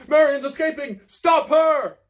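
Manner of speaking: high, loud alarm shout. Acted. Guard on the roof spotting the escape, loud shout. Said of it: "a voice from the roof shouting".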